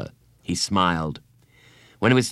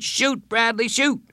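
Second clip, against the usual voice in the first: much higher, calling shoot to a teammate; cheering kids. Retold or imitated. Classmates calling on Bradley to shoot, cheering. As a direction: urge him on like a crowd of kids.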